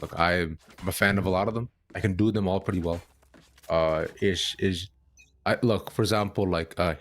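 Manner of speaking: ordinary talk, nothing marked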